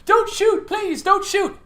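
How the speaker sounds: high panicked shout, please don't shoot; panicked surrender shout. Acted. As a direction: yell it in high-pitched panic. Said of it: "I can do it better".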